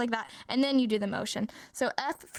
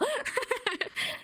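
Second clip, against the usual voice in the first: short, high-pitched laugh; 12 semitones higher than usual. Real, not acted. Teacher tells students the silly sentence is real, high laugh. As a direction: let out a high giggle at your own joke.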